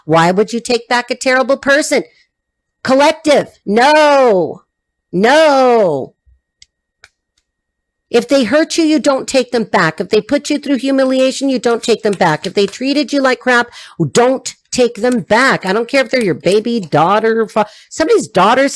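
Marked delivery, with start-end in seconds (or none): 2.8-6.1 louder, emphatic refusal to the collective; strong loud warning; firm loud no. Real, not acted. Firmly warns viewers against taking exes back. 14.1-15.7 slow stern. Real, not acted. Stern advice to viewers.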